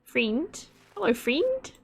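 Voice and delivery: high-pitched mock Australian FRIEND; imitated Australian accent. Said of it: "you trying to be me to say friend".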